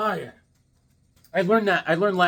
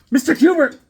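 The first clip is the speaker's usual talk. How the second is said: loud, high holler of a name; calling out; 11 semitones higher, 10 dB louder than usual. Real, not acted. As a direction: call out sharply, pitched way up. Suddenly calls out Mister Kubert's name.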